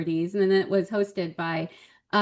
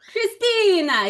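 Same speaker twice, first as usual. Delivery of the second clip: mock host call; 12 semitones higher, 6 dB louder, slower than usual. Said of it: "he was like".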